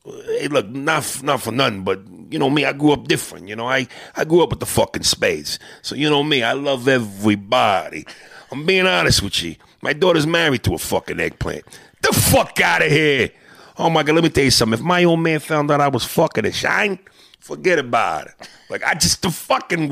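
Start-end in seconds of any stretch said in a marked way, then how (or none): 0.1-13.3 Italian-American wise guy. Acted. Host voicing an Italian character, brash Italian-American accent. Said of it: "come up with this Italian character". 13.8-18.5 a lightly voiced Italian-American uncle, quick; Italian-American wise guy. Host continuing the Italian bit, light Italian-American accent. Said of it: "this Italian character".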